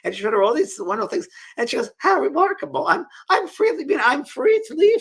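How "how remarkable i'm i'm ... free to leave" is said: amazed delight. Retold or imitated. Beatrice voiced, discovering freedom.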